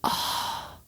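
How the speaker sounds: very slow whispered witch imitation; creepy Irish witch. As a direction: whisper it eerily, drawing out each word. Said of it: "sound so creepy, like a creepy Irish witch".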